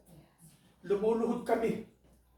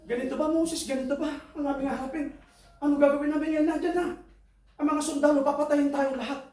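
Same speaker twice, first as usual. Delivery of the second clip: higher, pleading voice of frightened Israelites; panicked people; 7 semitones higher than usual. Retold or imitated. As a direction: plead with Moses, scared of the soldiers. Voicing frightened Israelites to Moses.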